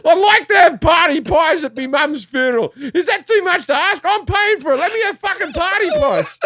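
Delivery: very high, hammed-up indignant rant; ranting outburst. Son ranting at his dad over funeral catering, exasperated. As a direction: build to a squealing mock tantrum. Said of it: "I'm like this".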